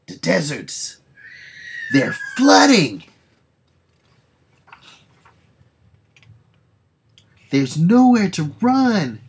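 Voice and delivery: high, loud, slow alarm; hammed-up doom-laden wail; panicked cry. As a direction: cry out as the floods come. Panicked narration of flooding.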